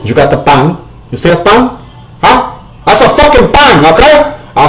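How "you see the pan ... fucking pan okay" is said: high, incredulous pointing; exasperated. Real, not acted. Showing viewers the pan, high and exasperated. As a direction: demand they look at it.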